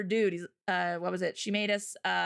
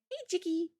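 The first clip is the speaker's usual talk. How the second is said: cheery greeting; 7 semitones higher than usual. Retold or imitated. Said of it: "So I was like".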